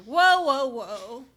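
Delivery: loud "whoa" that falls away; acted halting whoa. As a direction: call out to halt someone, then ease down. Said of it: "I would be like".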